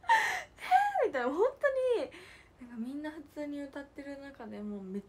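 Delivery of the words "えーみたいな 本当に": a drawn-out, much higher and louder eh; astonished eh. Retold or imitated. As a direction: act out being stunned. Retelling her astonishment. (驚いて高く大きく「えー」)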